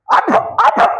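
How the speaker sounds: high, loud mocking taunt; picking a fight. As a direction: jeer like someone itching to quarrel. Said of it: "పోట్లాడే ముందు". (గొడవకు ముందు వెక్కిరింపు)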